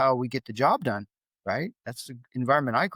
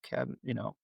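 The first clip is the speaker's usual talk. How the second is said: softer, gentle approach; lowered tone; 3 semitones lower, 7 dB quieter than usual. Shown as a demonstration. Dad showing how he approaches his sensitive son, toned down. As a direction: ease in softly to reach him. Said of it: "lower my tone".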